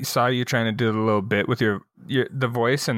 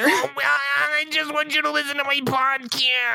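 put-on very high, wailing kid plea; whiny child voice. Kid begging people to hear his podcast, whiny child voice. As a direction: plead in a long childish whine. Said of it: "whiny child voice".

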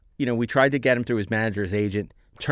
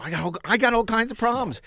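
Shatner voice; 9 semitones higher than usual. Retold or imitated. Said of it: "impersonating Shatner".

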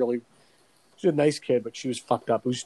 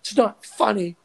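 mock furious girlfriend; 8 semitones higher than usual. Imitating the angry counselor girlfriend, higher indignant scold. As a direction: scold him like an outraged girlfriend. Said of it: "She's fucking furious".

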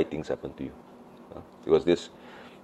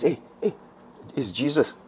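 dawning recognition; 8 semitones higher, 5 dB quieter than usual. Acted. Acts disciples' eyes opening.

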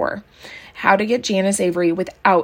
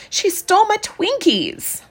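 put-on high, slow child's complaint; a little girl sulking over her loss; high whining child voice; whiny child; 12 semitones higher than usual. A narrator's reading. Maybelle repeating complaint.

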